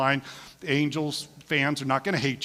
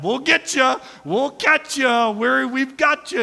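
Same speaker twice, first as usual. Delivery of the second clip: higher, slower, coaxing helpers' voice; encouraging helpers; 8 semitones higher, 4 dB louder, slower than usual. Retold or imitated. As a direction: cheer someone on through their first steps. Helpers encouraging patient to walk.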